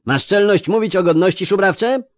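high, loud indignant shout. Acted. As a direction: throw the question back in his face. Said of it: "krzyknął Koinach Dareo".

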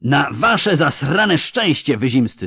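slow, loud drawn-out bellow; slow roar. Acted. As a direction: roar it out slowly. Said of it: "zaryczał znowu wojewoda". (wojewoda ryczy powoli do żołnierzy)

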